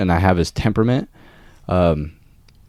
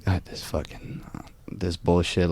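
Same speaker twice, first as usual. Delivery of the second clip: muttered under breath; 12 dB quieter than usual. Retold or imitated. Frustrated thought in his mind.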